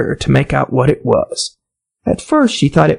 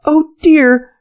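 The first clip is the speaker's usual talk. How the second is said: high, louder sorrowful cry; 12 semitones higher, 3 dB louder than usual. A narrator's reading. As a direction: wail over offending. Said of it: "in a sorrowful tone".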